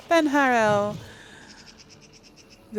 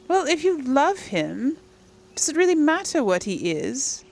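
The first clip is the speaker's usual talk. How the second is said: higher-pitched, lightly acted reading; player character's dialogue; 7 semitones higher than usual. Player voices advice on loving him, lightly acted.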